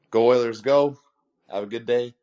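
ordinary talk, nothing marked

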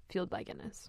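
near-whispered aside; hushed punchline. Pretend. Finishing the vampire joke for a co-host, hushed. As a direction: drop to a soft, breath-only whisper.

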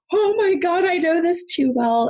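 high, crying-out gasp of recognition; shocked gasp. Retold or imitated. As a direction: cry it out as if the answer hits home. Recognizing a quiz answer.

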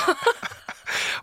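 high squeal laugh. Real, not acted. Reacting to the crude message.

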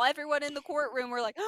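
usual speaking voice

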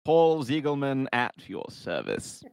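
upper-class English snob; posh Queen's English. Acted. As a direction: look down your nose in posh Queen's English. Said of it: "really cliche British... Southern Queen's English".

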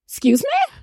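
high, shrill-free outraged squeak; indignant excuse me. Retold or imitated. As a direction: play it as a shocked, offended retort. Indignant mock reaction.

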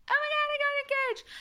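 very high engagement shriek; mock excited squeal. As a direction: squeal it to all your girlfriends. Imagined announcement to her girls.

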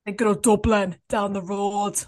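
hammed-up Northern accent; shocking put-on accent. Friend joking Dublin is down the road, exaggerated Northern accent. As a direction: overdo a Northern accent for a laugh. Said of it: "that accent's absolutely shocking".

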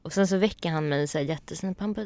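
usual speaking voice

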